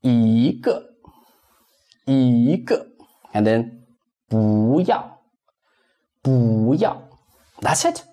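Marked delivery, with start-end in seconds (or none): 0.0-2.9 一个 with 一 rising as a second tone, then 个 falling; 一个 as a wave, rise then fall; changed tone. Shown as a demonstration. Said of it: "Read it into". 4.3-7.0 不要 with 不 rising as a second tone, then 要 falling; 不要 as a wave, rise then fall; changed tone. Shown as a demonstration. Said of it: "Read it into".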